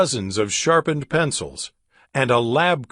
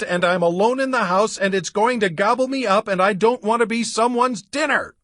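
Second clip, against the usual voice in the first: high, fast, breathless frightened babble; panicked rant; 9 semitones higher than usual. Acted. Gabby ranting about the monster to Drake, high fast panic. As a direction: rattle it off in a rising panic. Panicked girl rants on phone.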